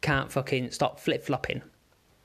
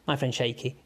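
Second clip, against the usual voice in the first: ordinary talk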